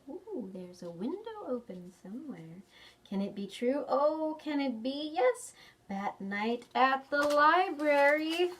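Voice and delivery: ordinary delivery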